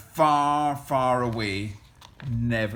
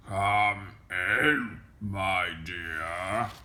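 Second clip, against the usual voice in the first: low, scratchy wolf posing as granny; deep, scratchy voice; 5 semitones lower, much slower than usual. A narrator's reading. Wolf answering Little Red's knock, deep scratchy voice. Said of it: "it was a deep, scratchy voice".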